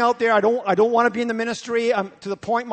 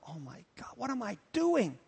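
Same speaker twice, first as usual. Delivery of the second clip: hushed realization; 10 dB quieter than usual. Retold or imitated. Pastor's sudden realization in garden.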